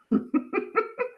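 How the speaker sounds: high little laugh at herself; amused at her own chaos, short laugh; self-mocking laugh. Real, not acted. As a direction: laugh it off lightly.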